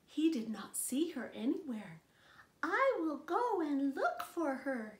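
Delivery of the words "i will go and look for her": much higher little chick voice; a tiny lost chick calling out; baby bird. Acted. Newly hatched baby bird looking for its mother, high chick voice. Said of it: "he said".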